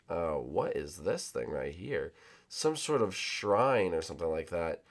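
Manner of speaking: ordinary talk, nothing marked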